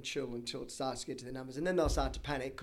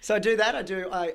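normal talking voice

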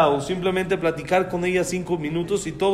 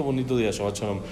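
ordinary talk, nothing marked

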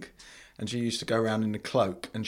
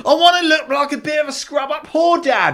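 put-on high, loud camp boy voice; mock ghost boy; 9 dB louder than usual. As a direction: squeal it at dad. Imitating the skirt ghost boy.

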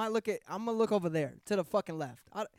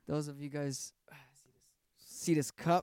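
whispered aside; 4 semitones lower, 3 dB quieter than usual. Acted. Sudden aside to viewers.

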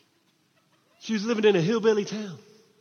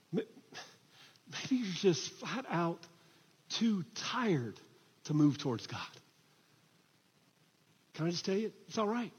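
hushed, much softer preaching; gentle reassurance; 9 dB quieter than usual. Real, not acted. Preacher comforts those too tired to move towards God, softly. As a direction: drop right down to comfort the weary.

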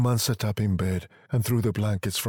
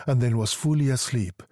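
plain reading voice

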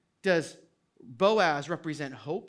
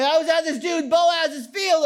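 louder, higher, eager "dude Boaz's field"; excited telling; 10 semitones higher, 7 dB louder than usual. Pretend. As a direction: blurt it out thrilled. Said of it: "but she said dude".

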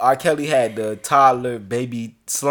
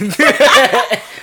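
high, loud laugh after the quote; big laugh; 11 semitones higher, 11 dB louder than usual. Real, not acted. Host laughing at R. Kelly's denial, high loud laughter.

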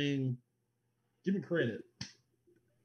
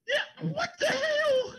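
laughing disbelief; slower than usual. Real, not acted.

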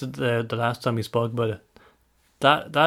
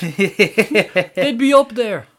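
drawn-out whine; 7 semitones higher, 4 dB louder, much slower than usual. Acted. Finishing mock plea, drawn out.

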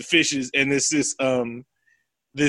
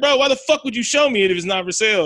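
fast, higher-pitched indignant retort; pissed-off complaint; 6 semitones higher, faster than usual. Acted. Host as Kevin Garnett refused the stone, pissed off. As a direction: fire off an acted, annoyed challenge. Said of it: "K G gets pissed. He's like".

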